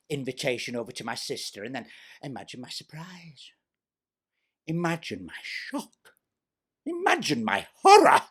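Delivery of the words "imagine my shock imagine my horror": mock horror. Mock-dramatic build.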